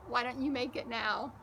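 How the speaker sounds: usual speaking voice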